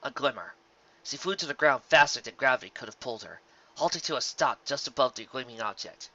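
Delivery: ordinary delivery, nothing marked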